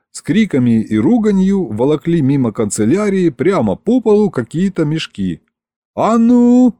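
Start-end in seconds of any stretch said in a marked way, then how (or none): 6.0-6.7 high, loud command; sudden raised shout; yelling. A narrator's reading. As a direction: yell to halt a rowdy crowd. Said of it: "заорал я".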